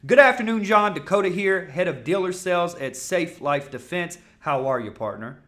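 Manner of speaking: loud, high phone greeting; coming off strong. Shown as a demonstration. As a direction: hit the greeting hard and high. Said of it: "I come off very strong".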